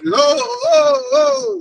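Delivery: high, loud hammed-up wail; mock wailing cry. Acting out a lost, confused believer with a mock panicked wail. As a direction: cry out like a chicken with its head cut off. Mocking a panicked double-minded man.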